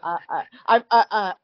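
stammering, stuck on I; stutter. Real, not acted. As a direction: stutter over the words. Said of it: "I just have to stutter".

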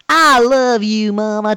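loud, drawn-out mock Southern drawl; southern accent. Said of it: "talking in a southern accent".

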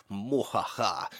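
slow, quiet mock-evil mwahaha; evil laugh. Criminal gloating over his hideout, slow soft evil laugh. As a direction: cackle it out like a cartoon crook.

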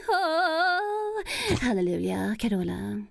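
a hint of the ecstatic gospel diva. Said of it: "religiösa, stora divan vi har i Sverige".

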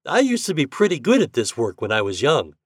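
usual speaking voice

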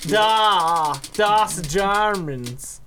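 slow, hammed-up fake German accent; mock German accent. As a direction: drag out das Germans in a joke German accent. Mock German on Oktoberfest theme.

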